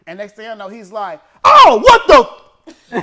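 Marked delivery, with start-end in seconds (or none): 1.4-3.0 sudden, high, very loud yelp; retold yell. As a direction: shout it right into someone's ear. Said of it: "He yells it in my ears".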